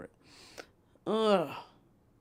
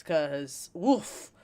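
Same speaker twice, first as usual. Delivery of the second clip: usual speaking voice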